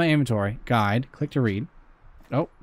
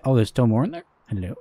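ordinary talk, nothing marked

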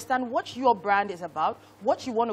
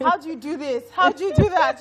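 high, loud pestering questions; mock helpless parent; 6 semitones higher, 10 dB louder than usual. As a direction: play up a clueless parent begging the kids for help, voice raised and pitched up. Imagines helplessly asking her kids.

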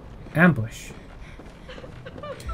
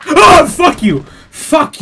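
high, loud burst of anger; outraged yell; 11 semitones higher, 8 dB louder, much slower than usual. Real, not acted. Player ambushed by a game statue, outraged yell. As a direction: curse it out at a shout. Yells at statue attacking him.

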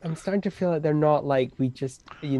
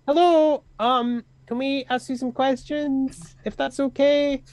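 high, timid, polite voice; polite Draconic greeting; 10 semitones higher than usual. Acted. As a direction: ask meekly and sweetly, much higher. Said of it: "I'll say in Draconic".

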